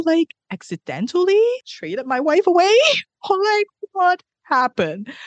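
rising high, a nervous laugh, then slowing down; husband panicking that he traded his wife away; panicked realization. Retold or imitated. Savage realizes wife vanished.

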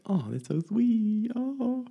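mock swooning girl cooing at a love story; sappy little girl. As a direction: melt over a romance scene like a swooning girl. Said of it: "I'm just a sappy little girl".

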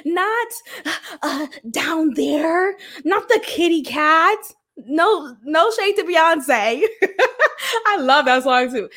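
usual speaking voice

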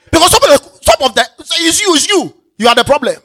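high, loud jab at the listener; pointed loud preaching. Real, not acted. Preacher telling congregation the problem is them, loud and high. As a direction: drive it home that it's you.

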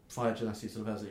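ordinary talk

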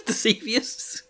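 amused, doubtful question on "Deceivious"; laughing at own made-up word. Shown as a demonstration. Host mocks her own coinage, saying "Deceivious" with a laugh.

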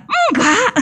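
a high, sing-song laughing "no"; sulky denial. Retold or imitated. Wife to husband, a high laughing sulky no. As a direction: deny it while obviously still angry, half laughing. Wife denies being angry.

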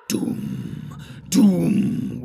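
long, resonant drum boom; booming drum imitation. A narrator's reading. Narrator voices orc drums in the deep, booming. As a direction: sound it like a huge drum in the deep. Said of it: "went the drums in the deep".

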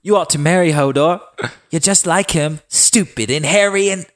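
high-pitched, hammed-up angry girl; mock girlish outburst, slowing on the insults; Sansa yelling. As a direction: throw it as a sisterly insult. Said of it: "Sansa yelled".

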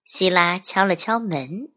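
usual speaking voice

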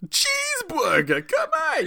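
high, drawn-out Jersey shout; weird Jersey guy. Pretend. Riffing with a friend on cheeseburger, hammed-up Jersey accent. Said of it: "for my weird Jersey guy".

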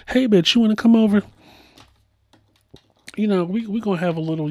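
high, breezy phone invitation. Acted. Star calling a friend to come over, high chatty invitation. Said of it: "she'll call any of us and be like".

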